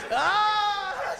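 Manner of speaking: very high, loud wail; exaggerated screaming cry. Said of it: "I'm gonna cry even louder".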